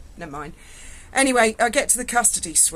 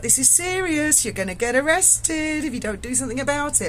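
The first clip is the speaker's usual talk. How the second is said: stern debt collector; 6 semitones higher than usual. Retold or imitated. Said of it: "he was like".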